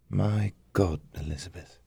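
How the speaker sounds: soft, hushed, trailing away; breathed in awe. A narrator's reading. Damien to his wife on reading the images, breathed softly. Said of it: "he breathed".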